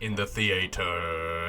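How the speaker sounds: low, hugely drawn-out stage voice; hammy actor savouring every syllable; mock thespian. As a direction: intone it slowly and grandly, as a joke. Jokingly echoes guest in stagey voice.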